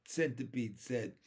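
plain reading voice

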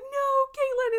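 put-on high, loud childish wail; child's wail. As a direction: call her sister back like a crying little kid. Crying child calling to sister.

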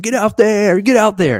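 high, loud cheer; cheering shout. Retold or imitated. Wife cheering her husband into the ring, high and loud. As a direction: urge him on from the stands.

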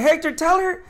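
high, loud call for backup; pissed-off appeal. Retold or imitated. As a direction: call on a coworker to back you up, indignant. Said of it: "but I was pissed".

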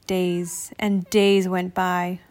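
ordinary delivery, nothing marked

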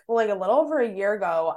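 ordinary talk, nothing marked

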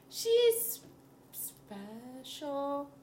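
drawn out, delicate pause; hesitant euphemism. Real, not acted. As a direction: say it carefully, choosing words. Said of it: "how do I put this nicely?".

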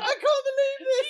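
laughing through a mock quote; giggling out the words; high laughing disbelief.

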